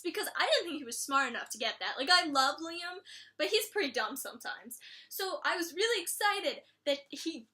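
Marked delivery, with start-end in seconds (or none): none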